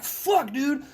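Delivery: retelling his inner panic at the casualty report, a sharp high curse; alarmed curse. Retold or imitated.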